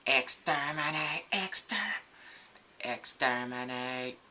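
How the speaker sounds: slow, grating robot monster cry; Dalek voice. Retold or imitated. Fan imitating a Dalek in a review, slow and mechanical. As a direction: drag out exterminate like a Dalek.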